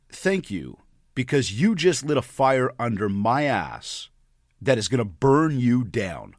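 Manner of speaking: slow, measured delivery; calm, deliberate threat. Retold or imitated. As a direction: retell it word by word, letting the warning land. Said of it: "in the calmest tone possible".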